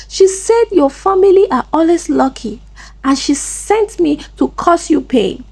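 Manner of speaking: high-pitched, agitated outpouring; mild shouted confession. A narrator's reading. Chisom on the ground confessing to Amaka, agitated cry. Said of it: "started shouting, shaking and confessing".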